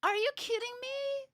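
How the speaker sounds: playful disbelief at her hippie joke; surprised, teasing. Real, not acted. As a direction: act amazed at your sister. Reacting to sister's joke.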